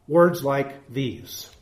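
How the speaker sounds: usual speaking voice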